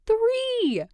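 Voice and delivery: high excited squeal on Three. Acted. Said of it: "she squeals".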